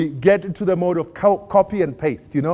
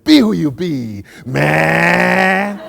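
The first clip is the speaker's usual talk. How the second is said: imagined grandmother line; 7 dB louder, much slower than usual. Retold or imitated. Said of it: "she would have said".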